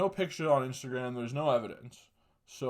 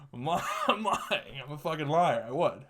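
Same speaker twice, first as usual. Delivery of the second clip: higher, laughing through the admission; laughing confession; 4 semitones higher than usual. Real, not acted. Comic admitting his own lie, laughing. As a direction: crack up while owning the lie.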